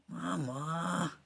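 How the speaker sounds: high, bad mum voice; horrible mother imitation. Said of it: "you imitated your mother horribly".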